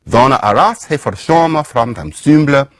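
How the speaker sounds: put-on reconstructed Old English accent with a Germanic lilt; half-invented Old English accent. Shown as a demonstration. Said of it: "I don't know what accent this is".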